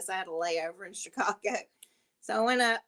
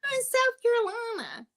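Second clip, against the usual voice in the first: put-on showing off Southern accent. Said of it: "retelling answer to bar guys".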